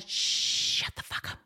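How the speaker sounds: slow, soft unvoiced whisper. Shown as a demonstration. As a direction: draw out a whispered order. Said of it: "number one thing to whisper".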